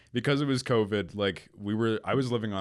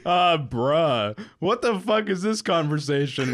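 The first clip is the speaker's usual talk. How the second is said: high, loud replay of being stunned; retold disbelief; 8 semitones higher, 6 dB louder, slower than usual. Friend retelling his reply to a shocking text, incredulous. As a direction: act out baffled disbelief at a friend. I don't know what to say.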